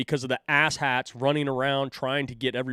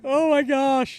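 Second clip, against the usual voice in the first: high and loud, stunned; amazed; 14 semitones higher, 6 dB louder, slower than usual. Real, not acted. Host hearing the complaint again, stunned and high-pitched. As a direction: gasp it out in amazement. Reacting to the replayed clip.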